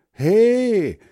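high loud call. Acted. Hans Frieder calling the running girl, loud call. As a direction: shout "He" after the girl. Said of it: "ruft Hans Frieder".

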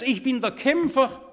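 loud, high-pitched mock boast; proud boast. Preacher imitating the pride Moses had to unlearn, boastfully. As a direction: proclaim it like a proud champion. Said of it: "nicht in diesem Stolz".

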